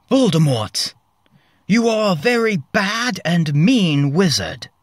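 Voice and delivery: loud high-pitched telling off; Harry shouting at Voldemort; savage accusation. A narrator's reading. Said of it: "Harry savagely said".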